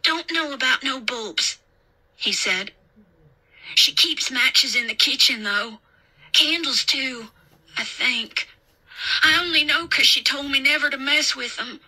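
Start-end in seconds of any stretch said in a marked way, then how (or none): 0.0-1.6 put-on higher child voice; lightly voiced rustic boy; little country boy. A narrator's reading. Boy answers the babysitter. 3.8-11.8 put-on higher, childlike voice; high boy's voice, tailing off then picking up; little country boy. A narrator's reading.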